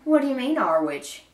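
starts high and loud, then drops; storybook shrug in the voice; dismissive question. A narrator's reading. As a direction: brush the claim off as a querying villager. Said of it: "he asked with a shrug".

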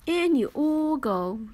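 ordinary delivery

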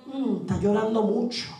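low-pitched, knowing devil's observation; lightly voiced devil taking note; scheming enemy. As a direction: drop low like the enemy sizing up prey. The enemy watches the believer crying.

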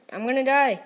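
high, loud gamer panic; alarmed cry. Real, not acted. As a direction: cry out as you're about to lose. About to be eaten in game.

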